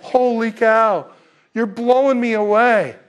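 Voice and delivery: hammed-up stunned disciple; overwhelmed Peter. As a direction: Peter imitated, floored and loud. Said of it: "I'd have fallen off the stool and just gone".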